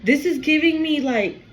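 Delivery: ordinary delivery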